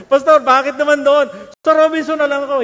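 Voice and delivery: high, loud begging protest; desperate plea. Retold or imitated. Ray pleading with the pastor not to go, loud desperate protest. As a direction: beg the pastor to change it.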